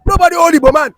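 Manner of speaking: loud and high, hammering the denial; angry shout. Real, not acted. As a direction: shout it down in protest. Heated debate, rejecting the claim.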